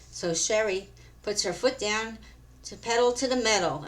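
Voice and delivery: ordinary delivery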